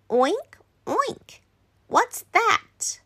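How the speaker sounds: high-pitched curious little Danny; curious child. Acted. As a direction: ask high, like a puzzled child. Child asking Grandpa in read-aloud story.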